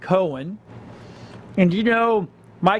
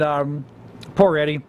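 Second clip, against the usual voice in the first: ordinary talk, nothing marked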